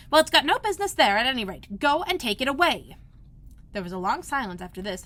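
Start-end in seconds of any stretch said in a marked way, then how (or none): 0.1-3.0 louder impatient order; Rabbit's voice. A narrator's reading. Rabbit ordering Pat, impatient and louder. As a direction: command him to clear it.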